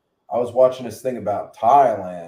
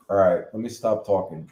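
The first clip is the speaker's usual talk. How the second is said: normal talking voice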